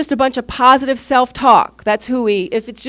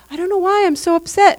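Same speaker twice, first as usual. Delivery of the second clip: bewildered friend; 8 semitones higher than usual. Retold or imitated. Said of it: "and they go".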